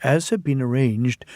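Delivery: usual speaking voice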